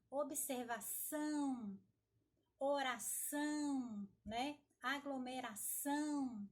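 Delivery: words stretched out slowly to show the stressed syllable; slowly sounding out the stressed syllable for students; slow stressed demo words. Shown as a demonstration. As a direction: pronounce each word slowly, leaning on the strong syllable. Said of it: "Igual eu falei".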